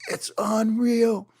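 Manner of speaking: urging him out with a hint of glee; imitating Jose Angel. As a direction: surfer friend urging him to paddle out, mildly voiced. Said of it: "And he goes".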